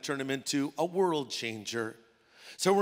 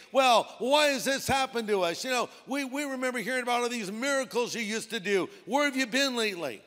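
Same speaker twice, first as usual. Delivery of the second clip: high-pitched mock complaint; complaining; 6 semitones higher than usual. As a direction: put on Gideon's aggrieved protest. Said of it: "he starts in with the complaints".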